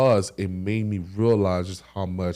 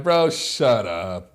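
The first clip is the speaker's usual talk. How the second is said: slow, drawn-out, louder brush-off; dismissive disbelief; 3 semitones higher, 5 dB louder, much slower than usual. Retold or imitated. Injured player brushing off friend's diagnosis, dismissive. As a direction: stretch it out, refusing to believe it.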